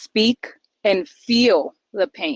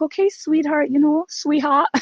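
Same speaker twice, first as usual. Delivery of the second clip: higher, syrupy sweetheart voice; sugary sweet impression; 6 semitones higher than usual. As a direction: coo it like an over-sweet friend. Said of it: "Was that your impression of me?".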